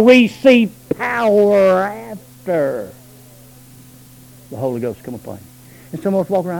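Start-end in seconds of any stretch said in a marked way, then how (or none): none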